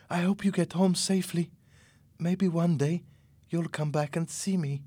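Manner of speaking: high, gentle character voice; tender young woman, Carmen's farewell. A narrator's reading.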